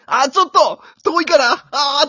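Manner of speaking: loud, high, panicked shouting; frantic commentator yell. Retold or imitated. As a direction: yell at a baton pass that's too far. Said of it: "とか言って". (慌てて叫ぶ解説者)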